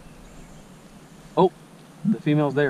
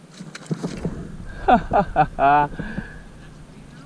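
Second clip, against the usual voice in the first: breathless happy laugh after the catch; excited laughter; 5 semitones higher than usual. Real, not acted. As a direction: laugh it out in relief.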